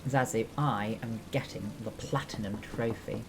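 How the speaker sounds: ordinary talk, nothing marked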